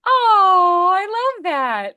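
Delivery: high, loud, long drawn-out "Oh"; delighted. Real, not acted. Coach hearing student's husband has a crazy accent, delighted. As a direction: gush it high and stretched out.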